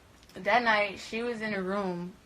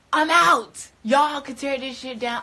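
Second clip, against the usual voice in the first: loud, high burst, then easing; acted-out storming off; retold defiant outburst; 6 semitones higher, 8 dB louder than usual. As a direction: declare you're done, loudly. Acted announcement of leaving.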